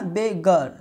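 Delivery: बेगर split as "be-gar"; syllable breakdown. Shown as a demonstration. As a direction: separate बेगर to show "uh" before the r. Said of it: "अ आ रहा है ना". (बेगर तोड़कर "बे-गर")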